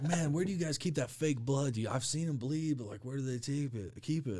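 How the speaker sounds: high put-on voice of a clueless buddy; naive coworker. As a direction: ask it like a wide-eyed coworker. Same coworker quoted.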